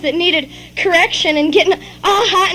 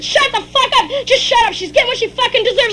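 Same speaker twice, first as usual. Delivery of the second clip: higher-pitched angry shouting back; angry retort; 6 semitones higher than usual. Acted. Father shouting down mother mid-beating, angry retort. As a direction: cut her off, voice raised.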